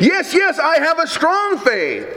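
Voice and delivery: high-pitched, mock-desperate insistence; desperate assent. Dangling tourist to the voice from heaven, acted desperate insistence. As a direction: act eager to convince, pitch lifted.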